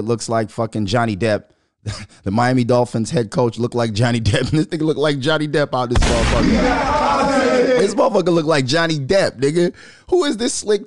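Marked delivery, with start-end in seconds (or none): 4.5-10.8 loud, laughing, higher shout. Real, not acted. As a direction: crack up while yelling it out. Laughing rant about coach's looks.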